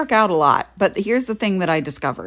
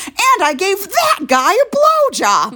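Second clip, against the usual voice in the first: high, raised retold boast; really loud announcement; 12 semitones higher, 5 dB louder than usual. Telling her friend as they leave the bar, loud announcement. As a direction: blurt it high for the whole line to hear. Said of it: "On your way out really loud".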